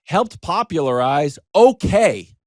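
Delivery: milk the line for laughs; slow mock reading. Reading Van Buren's accomplishments to listeners: slow mock-grand. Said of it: "it also says".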